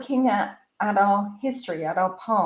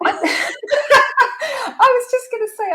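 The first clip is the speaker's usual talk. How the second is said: high, slow laugh through words; laughing as she cuts in; 14 semitones higher, 5 dB louder, much slower than usual. Real, not acted. Laughs while cutting in.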